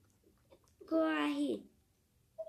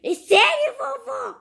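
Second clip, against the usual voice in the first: higher and loud with delight; excited; 8 semitones higher, 10 dB louder, slower than usual. Pretend. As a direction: gasp it out thrilled. Thrilled at training news.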